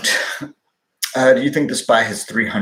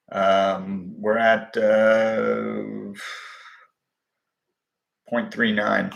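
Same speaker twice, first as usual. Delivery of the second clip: usual speaking voice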